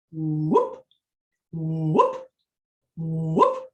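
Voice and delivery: low whoop flicking sharply upward; short rising whoop, repeated with pauses; diaphragm whoop. Shown as a demonstration. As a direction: start low and flip the sound up. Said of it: "try this".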